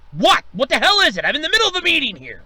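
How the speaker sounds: loud, high, fast annoyed reply; irritated snap. Acted. Boss snapping at the intercom mid-meeting.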